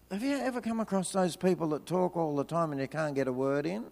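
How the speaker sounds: ordinary talk, nothing marked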